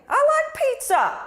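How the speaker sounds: high, loud and eager; enthusiastic pizza line. Shown as a demonstration. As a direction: sell it like you mean it. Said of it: "those are two totally different things".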